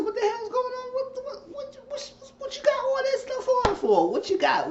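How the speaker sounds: very high, stammered disbelief; imitated flustered, high-pitched outburst; mock shock. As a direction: play a man squeaking in shock at the scene. Retold man walking in, shocked.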